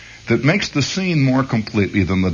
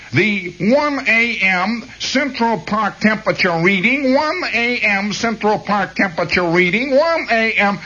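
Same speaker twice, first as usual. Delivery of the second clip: stuck tape loop; 10 semitones higher than usual. Retold or imitated. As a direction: sound like a jammed tape. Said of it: "She gets hung up ... tape gets balled up".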